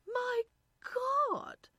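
loud, high-pitched exclamation of astonishment; shocked cry. A narrator's reading. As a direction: cry out in startled recognition. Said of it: "Irene cried".

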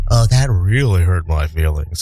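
low, deep sarcastic put-on; mock hurt. Man brushing off a nerd taunt, low sarcastic mock hurt. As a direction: drop the voice way down for fake wounded feelings.

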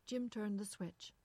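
plain reading voice

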